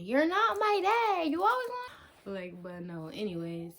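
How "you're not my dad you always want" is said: high, loud drawn-out whine-yell; hammed-up, high-pitched protest, stretched out; mock tantrum. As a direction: wail it slowly like a defiant kid. Mimics tantrum at bossy brother.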